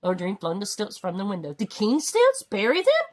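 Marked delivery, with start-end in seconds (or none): 1.5-3.1 astonished. A narrator's reading. Eric shocked by the order.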